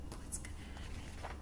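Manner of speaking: hushed whisper of suspense; suspenseful whisper. A narrator's reading. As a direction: lean in and whisper the question. Suspense question to kids.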